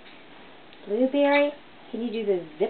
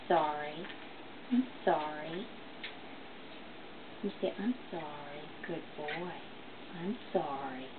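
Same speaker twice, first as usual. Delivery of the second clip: soft coaxing; 6 semitones lower, 9 dB quieter than usual. Real, not acted. Gently coaxing parrot to apologise.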